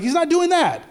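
ordinary talk, nothing marked

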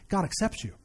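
quiet, earnest correction; hushed insistence. Real, not acted. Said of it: "No, you don't understand".

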